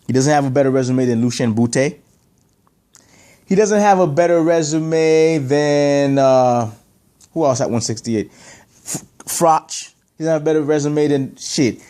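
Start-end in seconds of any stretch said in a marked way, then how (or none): 3.5-9.9 slow, drawn-out words while thinking; searching for a name. Real, not acted. Commentator hunting for another boxer's name, drawn-out stalling. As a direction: stretch it out as you hunt for the name.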